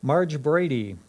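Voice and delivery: usual speaking voice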